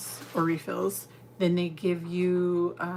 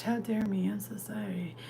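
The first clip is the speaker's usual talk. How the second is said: mock guilty whisper; 2 semitones higher, 5 dB quieter than usual. Mock apology to unsupervised chat.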